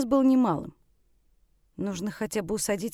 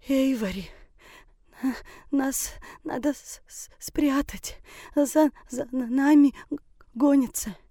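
high, stammering through chattering teeth; shivering, teeth knocking on each word; 5 semitones higher, slower than usual. Acted. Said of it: "Стуча зубами, еле проговорила гостья".

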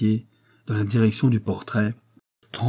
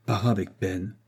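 ordinary delivery, nothing marked

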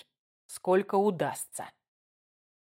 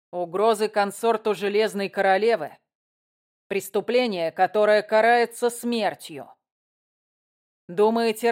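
louder, cold menacing prince; 3 semitones higher, 5 dB louder than usual. Acted. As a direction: threaten with icy authority. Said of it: "Голос Кирана звучал холодно".

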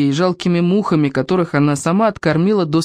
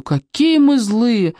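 mock reproach; 6 semitones higher, much slower than usual. Stranger teasing at door.

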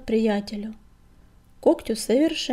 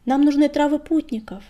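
higher, louder narrated call; 5 semitones higher, 7 dB louder than usual. A narrator's reading. Крутобок asks the healer for travel herbs, calling out. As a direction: call into a den for attention. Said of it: "позвал Крутобок".

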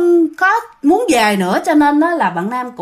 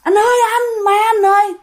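high frightened call for help; scared cry; 9 semitones higher than usual. Retold or imitated. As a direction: cry out to him in fear. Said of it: "Bạn sợ bạn la".

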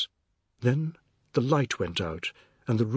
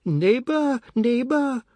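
very high, loud neighbour's call; piercing sing-out to the neighbours; shrill cry; 13 semitones higher, 5 dB louder, slower than usual. A narrator's reading. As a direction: call shrilly from next door. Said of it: "a woman cried shrilly from next door".

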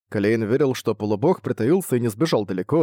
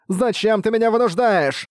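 hysterical shriek; 10 semitones higher than usual. A narrator's reading. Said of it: "Под этот резкий визг".

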